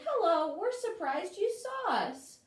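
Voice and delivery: high, bright little bug voice; lightly voiced chirpy bugs. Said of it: "So the bugs say".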